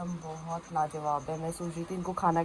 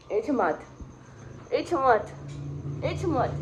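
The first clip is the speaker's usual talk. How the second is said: calling the dog; 5 semitones higher, slower than usual. Real, not acted. Calling her dog Samrat.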